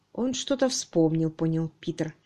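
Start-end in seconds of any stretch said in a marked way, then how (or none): none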